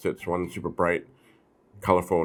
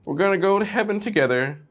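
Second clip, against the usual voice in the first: high, louder mock grandma calling her angel; 13 semitones higher, 4 dB louder than usual. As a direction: sing out like a doting ghostly grandma. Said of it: "She's reaching out to Match Girl going".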